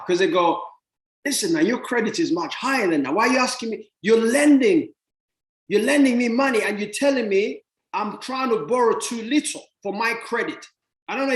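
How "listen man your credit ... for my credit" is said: an eager lender who can't believe you're asking so little; lender insisting eagerly. Retold or imitated. Lender urging the borrower to take more, eager.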